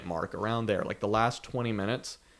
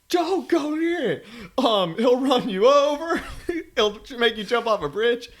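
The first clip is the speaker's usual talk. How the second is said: mock warning shout; 12 semitones higher, 5 dB louder than usual. Joking about a sign of Jeff in the woods, mock warning shout. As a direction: shout it like a spooky roadside warning. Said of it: "It's like".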